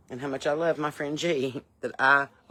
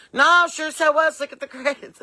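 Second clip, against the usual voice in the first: loud insisting; 11 semitones higher, 7 dB louder than usual. Retold or imitated. Groggy insisting to dental staff.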